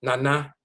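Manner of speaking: "Nana" with open ah vowels, nah-nah; both syllables as nah, not nan-uh; Akan pronunciation. Shown as a demonstration. Teacher showing students the Akan way, open ah vowels. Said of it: "This is how it's pronounced".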